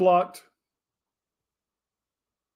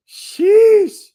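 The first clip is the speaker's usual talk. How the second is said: sheesh; 12 dB louder than usual. Real, not acted. Reacting to troll comment.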